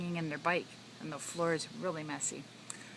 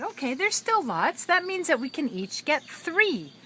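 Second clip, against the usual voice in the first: put-on excited kids voice; 9 semitones higher, 8 dB louder than usual. Kids voiced in read story.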